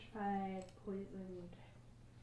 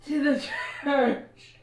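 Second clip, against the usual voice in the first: put-on greedy old priest; 3 semitones higher, 9 dB louder than usual. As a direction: lean in and wheeze the price.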